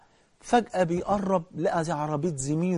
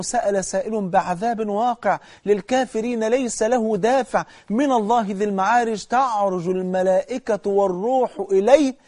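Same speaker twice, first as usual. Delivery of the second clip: slow, measured tajweed chant; Quran recitation; 4 semitones higher, slower than usual. Acted. Preacher reciting a verse to the congregation, slow tajweed chant. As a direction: draw each verse out like a reciter in the mosque.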